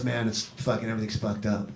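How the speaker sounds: low, subdued mock misery; soft, downcast imitation of drunken upset. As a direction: quietly play a dejected drunk friend. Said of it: "he shows up very drunk to my house, mid-afternoon, upset".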